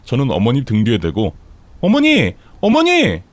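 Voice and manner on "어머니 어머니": high, urgent calls after someone; repeated cries, pitch climbing; loud calling. A narrator's reading. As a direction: call out to the departing mother-in-law. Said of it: "하면서 크게 불렀지만".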